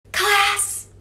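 usual speaking voice

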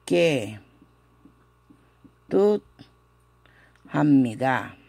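drawn-out syllable by syllable; very slow pronunciation. Shown as a demonstration. Teacher finally models Ketuthamida for students, very slow. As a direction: stretch Ketuthamida out very slowly. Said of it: "es difícil esta pronunciación".

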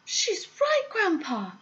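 put-on high-pitched excited child; crying out. A narrator's reading. Child confirming the sighting to grandpa, crying out. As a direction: pipe up eagerly, high. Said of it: "cried Charlie".

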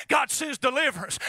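ordinary talk, nothing marked

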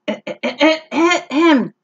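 nervous throat clearing. Acted.

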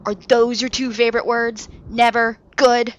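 mocking echo. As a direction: parody the captain's gloomy line. Flynn mocking captain's words.

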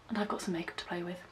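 quick whispered West Country vlogger aside. Real, not acted. Teasing viewers with a whispered makeup promise. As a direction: breathe it out as a secret.

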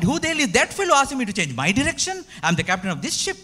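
the captain bristling at being told what to do; annoyed captain. Acted. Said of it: "Captain got annoyed".

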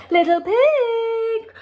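high, long singsong hail; calling out. A narrator's reading. Wolf trying a new trick, calling out. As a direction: stretch out the call to the pig. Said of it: "called the wolf".

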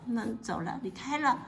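plain reading voice

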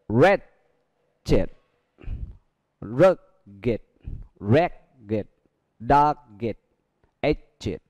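"rugged" and "ragged" with id endings. Shown as a demonstration. Teacher modelling adjectives for students, id ending. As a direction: model each word slowly, ending id. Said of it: "Tiếp theo đó là".

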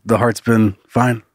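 ordinary talk

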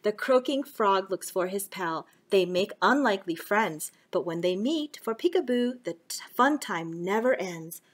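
lively storybook reading; reading to children. Acted. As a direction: read it to little kids. Reading a picture book.

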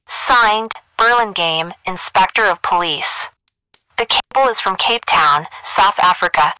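high, thin telephone voice; girl over the wire. A narrator's reading. Operator rings the doctor at night, high telephone voice. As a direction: telegraph operator through a phone line. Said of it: "A girl's voice announced over the wire".

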